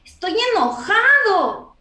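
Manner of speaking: put-on a child's slow, loud angry claim. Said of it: "no sabemos expresar las emociones".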